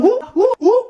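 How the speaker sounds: high, short "uh" hoots; quick run of rising whoops; involuntary "wuh" tic. Acted. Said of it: "bilang, "Wuh".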